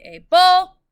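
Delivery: able with the le syllable hit high and loud; stress on last syllable. Shown as a demonstration. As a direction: say able punching the final le. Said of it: "which doesn't make much sense".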